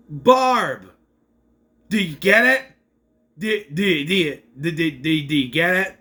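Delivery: loud, stuttering over-obvious joke nudge; mock nudging. Recapper mocking the show's obvious Barb reference, exaggerated nudging. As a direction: hammer the gag home, tripping repeatedly over the words.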